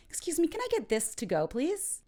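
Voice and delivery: high, quick, rising polite request. Acted. Diner asks server for leftovers to go, polite request.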